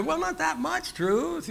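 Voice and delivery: high, slow mock whine; a put-on timid whine; mock squeamish voice. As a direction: play the person backing off the truth. Mocking truth-averse person.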